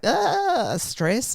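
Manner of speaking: a sudden high "Ah" then a deflated "stress"; mock stressed sigh. Teacher mimics a child's idea of stress with a mock high gasp. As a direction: play up "stress" as tension, jumping high on "Ah". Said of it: "stress sounds like".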